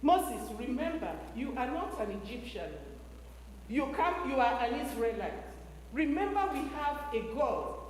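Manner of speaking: put-on mother teaching young Moses, tender hushed voice; tender reminder. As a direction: murmur it to your son like a lullaby. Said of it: "She told Moses".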